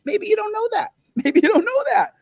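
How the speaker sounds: high sarcastic taunt with a laugh. Acted. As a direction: taunt them in a squeaky voice.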